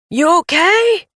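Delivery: high loud call over engine; hollering. A narrator's reading. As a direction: holler the question across. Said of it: "he hollered".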